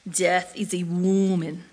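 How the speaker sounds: slow, low gravelly father's voice; deep gravelly voice. Retold or imitated. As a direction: lean into a rough, deep growl. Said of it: "deep and gravelly voice".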